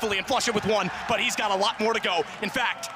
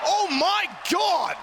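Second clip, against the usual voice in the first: slow, high, loud exclamation; excited shout; 11 semitones higher, 3 dB louder, much slower than usual. Real, not acted. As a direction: yell out in disbelief. Caster reacts to clutch.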